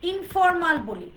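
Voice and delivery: ordinary talk, nothing marked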